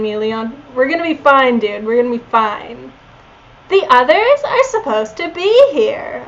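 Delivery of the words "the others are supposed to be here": put-on high Valley Girl uptalk; airy ditzy Valley Girl. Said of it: "I did a Valley Girl voice for Leon".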